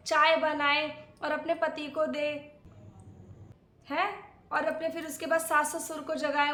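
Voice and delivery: ordinary talk, nothing marked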